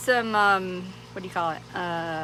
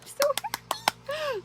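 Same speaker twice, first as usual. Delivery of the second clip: squealing happy; much slower than usual. Real, not acted.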